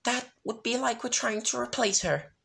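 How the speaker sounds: Rarity voice. Acted. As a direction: speak as a refined fashionista.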